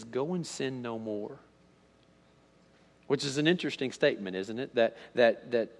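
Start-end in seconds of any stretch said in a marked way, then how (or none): 0.0-1.4 soft, slow, tender instruction; hushed and unhurried, as a kindly release; gentle sending-off. Retold or imitated. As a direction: quietly charge her, taking time. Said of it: "And then he says".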